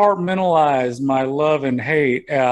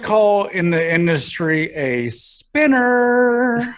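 slow; 6 semitones higher, much slower than usual. Acted. Mock announcer line about woman.

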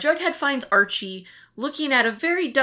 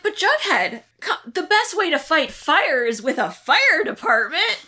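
high-pitched, eager Archie imitation; hammed-up earnest protest; mock earnest pleading; 8 semitones higher than usual. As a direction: plead the case like an overkeen boy. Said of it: "Archie's like".

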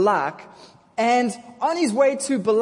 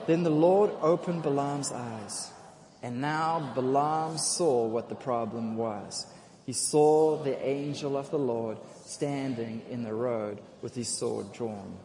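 scripture reading; 8 semitones lower, 5 dB quieter than usual. Real, not acted. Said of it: "let's read the scripture there".

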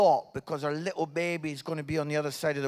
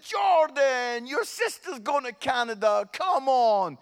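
higher, urgent appeal; exasperated dad; 4 semitones higher, 3 dB louder than usual. Retold or imitated. Dad urges son to care about sister leaving, exasperated. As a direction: plead with him to show some feeling.

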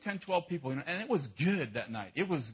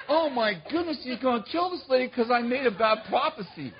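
mock panic; 7 semitones higher, 3 dB louder than usual. Panicked thought retold.

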